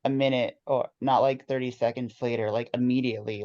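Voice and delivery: ordinary talk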